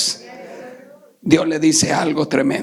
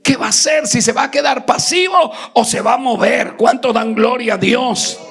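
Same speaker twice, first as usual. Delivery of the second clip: preaching at full force; 6 semitones higher than usual. Acted. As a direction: build the fire of the sermon. (predicación encendida)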